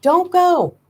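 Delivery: high pleading cry; desperate plea. A narrator's reading. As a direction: beg them not to leave. Begging departing car to stay.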